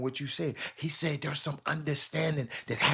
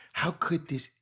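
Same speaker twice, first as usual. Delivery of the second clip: ordinary talk, nothing marked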